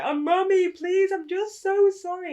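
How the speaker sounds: high, loud, slow mock wail; hammed-up tearful apology; pleading child. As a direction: beg Mummy in a drawn-out childish voice. Child begs mum's forgiveness.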